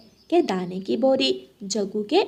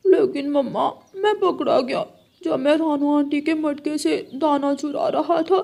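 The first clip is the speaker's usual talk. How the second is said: put-on higher child voice for the young crow; high little crow; 5 semitones higher than usual. Young Jaggu tells his mom he got caught, high child voice.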